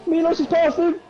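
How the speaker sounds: real high-pitched excitement; excited race call. Real, not acted. Commentator announcing Milos is past, excited. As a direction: call the overtake high and urgently. Commentator calls an overtake live.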